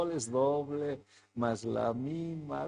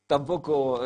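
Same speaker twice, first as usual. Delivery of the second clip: ordinary talk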